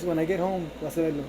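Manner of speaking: soft, menacing dad warning; low warning. Retold or imitated. Dad on the phone warning his son, soft and menacing. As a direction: quietly threaten what comes at home. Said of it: "say it in Spanish".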